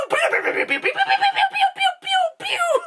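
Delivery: very high laughing pew pew sounds; squeaky toy-gun pews broken by laughter; kid's laser noises. Retold or imitated. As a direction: play zap noises like a gleeful child. Kid playing with the rocket toy.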